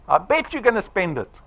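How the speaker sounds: usual speaking voice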